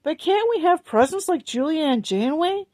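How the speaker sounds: high pleading wail. A narrator's reading. Fanny begging her mother for presents, high wail. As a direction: plead high like a small child. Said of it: "wailed Fanny".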